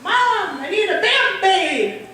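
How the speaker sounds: high, loud call for Mom; yelling child. Retold or imitated. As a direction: kid hollering through a screen door. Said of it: "yelling through the screen door".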